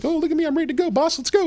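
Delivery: high-pitched eager suck-up voice; mock kiss-ass. Employee showing off to the boss, mock kiss-ass. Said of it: "'cause I'm a kiss ass".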